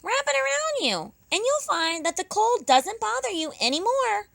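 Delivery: high hare voice giving advice. Acted. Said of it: "she said".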